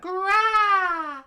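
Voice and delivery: high, loud beast roar; monster screech. Acted. Lupin attacking the heroes, with a screeching roar. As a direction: screech like an angry snow creature. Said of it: "the Lupin screamed out".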